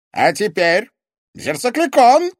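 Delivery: high, loud villain's command; loud, greedy-villain demand; imperious demand. A narrator's reading. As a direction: demand the prize at full voice. Villain demanding the magic mirror item.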